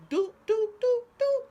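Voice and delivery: high squeaky doop doop mimicking the machine buttons; button beep noises.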